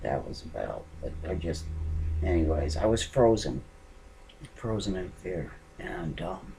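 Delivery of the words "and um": normal talking voice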